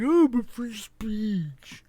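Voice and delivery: high, slow whiny mock voice. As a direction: sneer the phrase like a whiner. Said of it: "guys who are always like".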